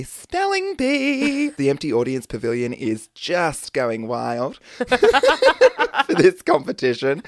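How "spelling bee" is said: announcer voice. Acted. Announcing the spelling bee.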